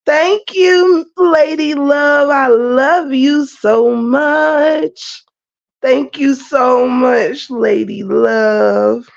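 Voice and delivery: slow, high, drawn-out gratitude with a catch of crying; touched thank-you. Real, not acted. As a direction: stretch each word out, moved by the gift. Thanking a viewer in chat.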